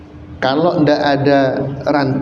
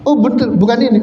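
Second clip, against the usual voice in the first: much higher, surprised jin checking; 11 semitones higher, much faster than usual. Acted. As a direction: jin double-checking with the old man, voice high.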